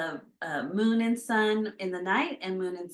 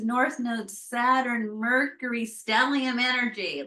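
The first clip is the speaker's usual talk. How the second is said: usual speaking voice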